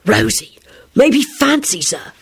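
slow, whispered hiss. A narrator's reading. William hisses his theory to Rosie, whispering. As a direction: hiss it under the breath. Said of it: "William hissed".

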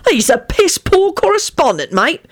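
high, peeved complaint; aggravated, high-pitched moan; irritable. A narrator's reading. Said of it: "he told Lupin irritably".

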